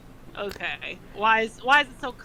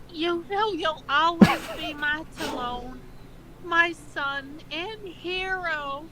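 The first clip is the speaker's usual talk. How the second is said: a hint of a higher, tender mother voice, slow at the end; lightly voiced mother; 7 semitones higher, slower than usual. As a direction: read it as a doting mother, lightly. Reading mother's line in voice.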